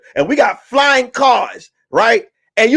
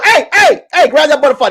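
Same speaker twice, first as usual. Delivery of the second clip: mock cop shout; 7 semitones higher, 3 dB louder than usual. Cops chasing a butterfly.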